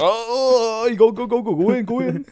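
high, loud, eager; excited welcome. Retold or imitated. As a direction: wave him in excitedly. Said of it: "I was like".

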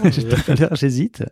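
laughing while echoing him; amused, laughing through the words. Real, not acted. Echoing host's remark, amused.

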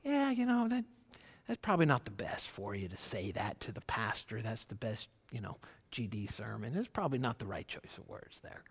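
gently steer him without embarrassing him; tender hushed correction. Retold or imitated. Small group friend tenderly correcting a new convert, hushed and soft. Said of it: "people just tenderly kinda like".

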